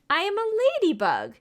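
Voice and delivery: high, bright-loud announcement of the answer; spring the answer like a surprise; excited reveal. Pretend. Teacher revealing the answer to children, excited and high.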